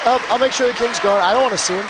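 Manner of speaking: normal talking voice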